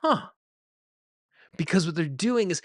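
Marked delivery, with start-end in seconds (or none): 0.0-0.3 short, high-pitched "huh" of discovery; mock little huh of insight; imitated realization. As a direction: imitate a client suddenly noticing something. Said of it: "I literally see them go".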